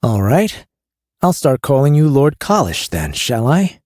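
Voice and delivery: a queasy smuggler; Carrion voice. A narrator's reading.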